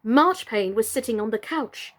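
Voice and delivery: ordinary delivery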